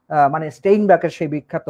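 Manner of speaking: ordinary delivery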